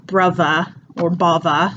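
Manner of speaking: "brother" and "bother" as bruvva and bovva. Shown as a demonstration. Teacher giving examples, "brother or bother" with V for TH. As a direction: put a Cockney V in "brother" and "bother". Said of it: "is the V".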